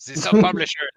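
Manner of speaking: animated, French-lilted gentleman author; French-accented mouse knight, enthusiastic. Acted. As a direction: flatter a fellow writer in a theatrical French accent. Mouse knight talks of publishing.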